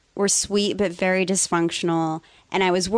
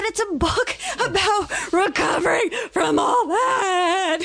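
high, slow shout breaking into laughter; laughing scream; 12 semitones higher, slower than usual. Retold or imitated. Niece yelling at her cousin about the aunt, laughing. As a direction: yell it through your own laughter. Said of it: "like, screaming".

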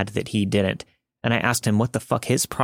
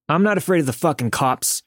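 screaming; 5 semitones higher than usual. A narrator's reading. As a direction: yell it right back at them. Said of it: "he screamed".

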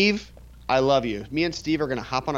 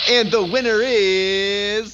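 slow, drawn-out winner reveal; announcer voice; 8 semitones higher, 5 dB louder, much slower than usual. Acted. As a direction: build suspense like a game show host. Drum roll winner announcement.

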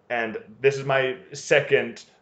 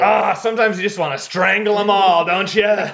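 loud, higher mock exasperated cop; cop ranting; 6 semitones higher, 5 dB louder than usual. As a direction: play the fed-up cop, hammed-up. Acting out the misogynist cop.

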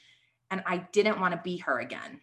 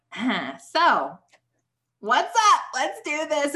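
loud, high, eager kickoff; pumped-up start; 7 semitones higher, 6 dB louder, much slower than usual. Retold or imitated. Woman starting her first podcast recording, pumped up. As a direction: hype yourself up to begin.

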